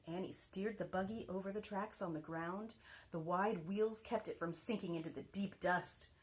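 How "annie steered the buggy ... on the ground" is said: usual speaking voice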